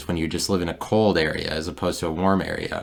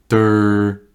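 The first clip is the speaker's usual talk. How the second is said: dumb mock grunt, louder; a self-mocking durr; goofy durr; 2 semitones higher, 5 dB louder than usual. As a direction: play the fool with a loud durr. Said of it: "Nervousness, guilt. I'm on a recorder".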